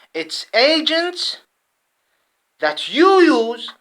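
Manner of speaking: loud, high and very slow, each word hammered; furious, deliberate accusation; angry slow accusation. Real, not acted. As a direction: drag it out and spit each word.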